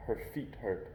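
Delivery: ordinary delivery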